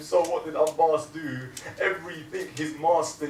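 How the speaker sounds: loud, high mourning sermon; preaching at full force. Acted. Preacher to mourners, loud and high in grief. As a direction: cry it out to the hall.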